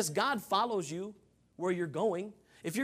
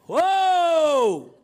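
long, loud, high whoa; drawn-out protesting shout; outraged holler; 8 semitones higher, 12 dB louder than usual. Pretend. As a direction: bellow it as if halting someone in shock. Mock outrage at trading flags.

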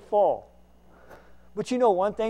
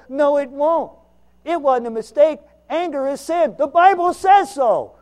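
emphatic retort; 6 semitones higher, 9 dB louder than usual. Retold or imitated. Retold preaching at counselor.

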